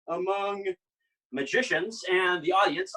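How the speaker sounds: usual speaking voice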